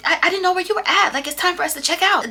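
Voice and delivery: mock frantic friend rushing. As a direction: ham up her frantic panic. Imitating frantic Coco.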